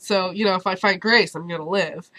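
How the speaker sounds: ordinary talk, nothing marked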